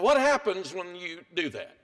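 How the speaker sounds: ordinary delivery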